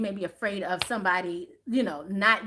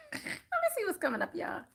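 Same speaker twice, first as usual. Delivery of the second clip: high giggling "let me see what's coming up"; laughing and amused; laughing through words; 10 semitones higher, 6 dB quieter than usual. Real, not acted. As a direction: laugh the words out much higher. Laughs while repeating the line.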